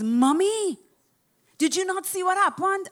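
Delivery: put-on high, loud excited child; child's voice. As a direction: call out like a young son. Son voiced in the story.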